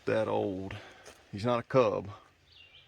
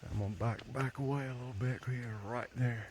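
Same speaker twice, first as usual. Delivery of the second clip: hushed; 6 dB quieter than usual. Real, not acted. Backing away from bear.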